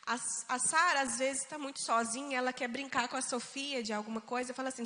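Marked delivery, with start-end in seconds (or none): none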